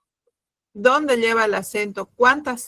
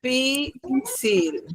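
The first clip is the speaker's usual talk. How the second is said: softer, broken into separate syllables; slow syllable-by-syllable; 5 dB quieter, much slower than usual. Shown as a demonstration. Teacher repeating a student's word, slow, softer, syllable by syllable. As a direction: split it up slowly for the class.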